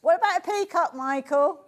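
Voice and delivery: ordinary talk, nothing marked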